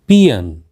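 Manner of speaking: पियन said as "PEE-un"; correct pronunciation. Shown as a demonstration. Teacher gives learners the correct word, carefully pronounced. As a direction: say पियन with a weak second vowel. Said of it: "इसका सही उच्चारण है".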